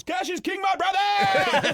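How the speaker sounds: high, loud, drawn-out put-on voice; hammed-up jubilant cabbie; booming mock cabbie cheer; cheerful taxi driver. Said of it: "He goes".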